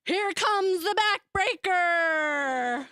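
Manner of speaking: loud, high announcer call with a long drawn-out backbreaker; mock wrestling shout. Brother shouting as he lifts little sister: wrestling-announcer yell. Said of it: "lay me over his knee and shout".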